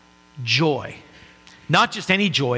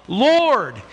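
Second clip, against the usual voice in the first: a lightly raised, higher call; mild mock yell; 7 semitones higher than usual. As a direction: cry out the name in recognition, held back. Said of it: "the disciples yell out".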